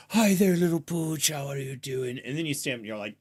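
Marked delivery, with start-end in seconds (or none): 0.1-2.2 playful greeting to a dog, lightly voiced; mock baby talk to dog. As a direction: coo at the pooch the way the player does. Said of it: "You're always like".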